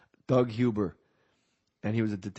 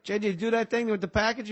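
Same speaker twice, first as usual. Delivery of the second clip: higher-pitched, mock Boston accent; 11 semitones higher than usual. West Side guy checking on his package, Boston accent. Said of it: "why they have Boston accents".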